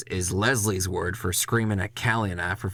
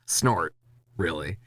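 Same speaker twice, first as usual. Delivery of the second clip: usual speaking voice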